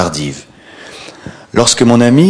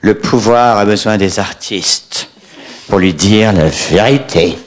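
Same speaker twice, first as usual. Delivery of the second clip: lent, surarticulé, doigt levé; syllabe par syllabe; slower than usual. Retold or imitated. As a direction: articule chaque syllabe comme un illuminé. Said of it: "articulant beaucoup trop, syllabe par syllabe". (en articulant beaucoup trop, syllabe par syllabe)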